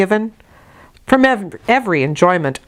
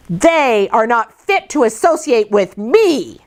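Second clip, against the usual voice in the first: cried out; 5 semitones higher than usual. A narrator's reading. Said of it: "I cried out suddenly".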